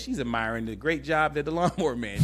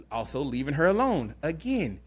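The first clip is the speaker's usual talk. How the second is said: usual speaking voice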